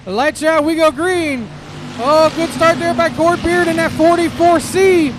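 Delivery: lively live race call; motorsport commentator. Real, not acted. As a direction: call the laps as they unfold.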